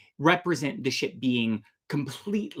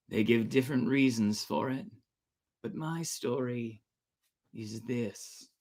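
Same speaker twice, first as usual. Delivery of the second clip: laid-back smoker; 6 dB quieter than usual. Acted. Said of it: "smoking a cigarette in this moment".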